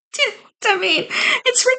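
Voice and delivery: high laughing question. Real, not acted. Vlogger laughing after mocking British spelling, giggly. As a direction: giggle through it.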